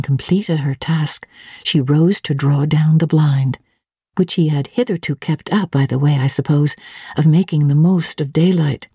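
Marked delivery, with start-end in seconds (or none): none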